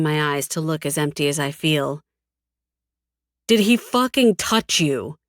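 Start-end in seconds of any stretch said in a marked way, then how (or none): 3.5-5.2 higher, forceful repeated demand; angry demand. A narrator's reading. Cyrus asking a second time as he grips her jaw, angrily demanding. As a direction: press harder, punching out the question. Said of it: "he asks again, painfully gripping my jaw".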